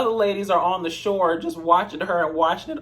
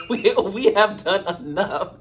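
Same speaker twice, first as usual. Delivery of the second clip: chuckling through the words, slowed; breaking into laughter mid-sentence; laughing; 3 semitones higher, slower than usual. Real, not acted. Vlogger cracking herself up at her joke, laughing.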